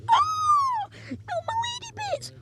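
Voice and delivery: way up high, falsetto wail; shrill mock scream. Girl stabbed in the skit, falsetto squeal.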